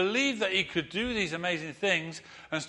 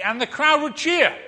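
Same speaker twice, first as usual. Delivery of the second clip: excited; 11 semitones higher, 9 dB louder than usual. A narrator's reading. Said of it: "narrating crowd cheer".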